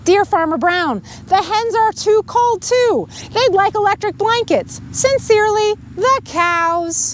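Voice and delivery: put-on lively read-aloud to children; storybook reading. A narrator's reading. Reader telling children the farm story, lively read-aloud. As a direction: tell it like a bedtime story. Reads picture book to kids.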